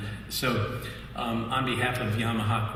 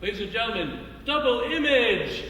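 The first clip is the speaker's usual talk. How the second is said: stage announcer; 12 semitones higher than usual. Retold or imitated. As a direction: introduce the act like a showman. Announcing the band onstage.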